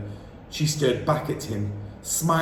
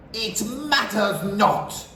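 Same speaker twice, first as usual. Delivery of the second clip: slow, a hint higher proclamation; witch's call; 6 semitones higher, slower than usual. A narrator's reading. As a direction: dismiss it grandly. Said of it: "shouted the Grand High Witch".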